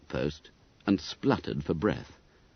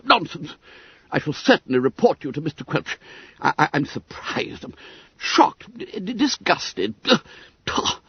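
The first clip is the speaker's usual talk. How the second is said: high, fast sputtering indignation; stammering outrage; 5 semitones higher than usual. Acted. Secretary refuses the excuse, high spluttering temper.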